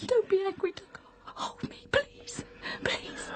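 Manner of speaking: soft pleading whisper; hushed trembling please; frightened whisper. Acted. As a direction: whisper the plea, scared. Wife clinging in fear.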